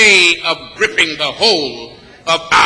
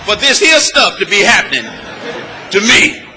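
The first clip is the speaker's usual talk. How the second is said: loud, laughing cry of ungrammatical frustration; exasperated folk voice; 3 dB louder than usual. Retold or imitated. Preacher mocking a fed-up believer, loud and laughing. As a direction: holler it like you've had enough. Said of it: "You find yourself saying".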